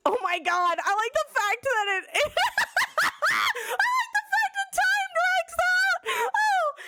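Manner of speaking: words squeezed out through high, loud laughter; can't stop laughing mid-sentence; laughing fit. Real, not acted. Cracking up at the film.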